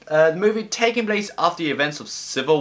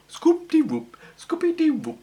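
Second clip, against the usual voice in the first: high cartoon Scooby-Doo yelp; squeaky high cartoon dog call; 12 semitones higher than usual. Imitates the Scooby-Doo cartoon theme.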